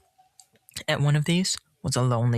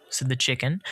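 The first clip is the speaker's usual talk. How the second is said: ordinary delivery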